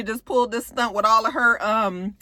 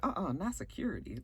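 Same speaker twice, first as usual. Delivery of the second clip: low disbelieving uh-uh; 3 semitones lower, 16 dB quieter than usual. Retold or imitated. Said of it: "Nene was like".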